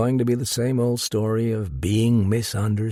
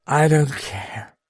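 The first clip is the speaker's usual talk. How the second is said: slow, slurred, higher tipsy woman; lightly slurred defiant shrug; slurred accent; 5 semitones higher, 3 dB louder than usual. A narrator's reading. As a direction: let the words slide, half drunk. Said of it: "she said in that slurred accent".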